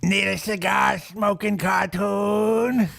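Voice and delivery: slow, put-on cartoon character; cigar-smoking cartoon voice. Shown as a demonstration. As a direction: chew the line like a cartoon tough guy. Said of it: "I can do many voices".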